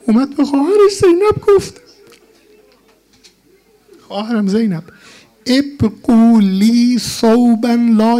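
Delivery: drawn-out rowzeh lamentation; mournful preaching. Acted. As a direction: deliver a grieving martyr's plea to his sister. Mourning preacher voices Hussein's plea.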